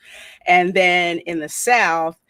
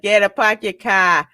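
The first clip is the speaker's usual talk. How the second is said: mock New England Yankee accent; playful New England accent impression; 2 semitones higher, 7 dB louder than usual. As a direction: lightly put on a broad Yankee accent, a touch louder. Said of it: "Don't forget the damn Yankee accent".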